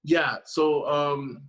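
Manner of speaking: ordinary talk, nothing marked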